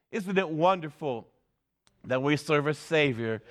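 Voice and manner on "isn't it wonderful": ordinary delivery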